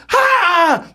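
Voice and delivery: high, hammed-up scream; high-pitched mock terror; retold scream. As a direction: cry out as the bird lands on you. Hawk descends on him, retold.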